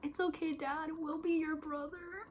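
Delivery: high tearful voice, imitating the son; tearful comforting son. Vlogger quoting the son while crying, high tearful voice. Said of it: "he's like".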